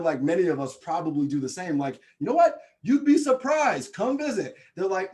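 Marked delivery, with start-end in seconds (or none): 2.2-4.6 high, loud sales-pitch enthusiasm; eager pitch. Retold or imitated. Midwesterner urging skeptics to visit, eager pitch. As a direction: act out talking the Midwest up, voice lifted.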